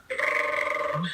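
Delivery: lips buzzed like a revving car; engine noise. Retold or imitated. Imitating car in pit lane.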